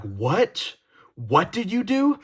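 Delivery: mock stern teacher rising to a high demand; imitating a teacher getting in a kid's face; retold angry teacher. Teacher confronting the child, mock angry high demand.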